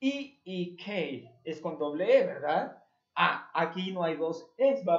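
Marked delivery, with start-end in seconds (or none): 0.0-1.3 letter by letter, slow; spelled out slowly. Shown as a demonstration. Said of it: "La palabra semana es W". (deletreando despacio)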